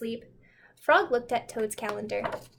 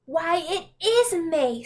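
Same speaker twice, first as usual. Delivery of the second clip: higher, slow wonder; pleased surprise; 5 semitones higher, 4 dB louder, slower than usual. Acted. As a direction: savour the discovery. Toad surprised it's May.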